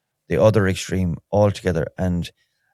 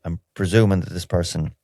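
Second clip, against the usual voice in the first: ordinary talk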